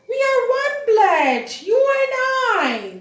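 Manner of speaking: high, loud monkey chant. Acted. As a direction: call it out like a monkey troop. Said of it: "The monkeys are saying that".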